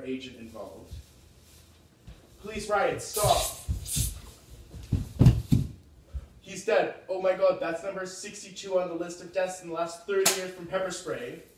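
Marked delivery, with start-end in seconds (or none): 2.4-11.4 higher, louder frantic shouting; panicked bystander. Acted. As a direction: shout in a panic at riot police.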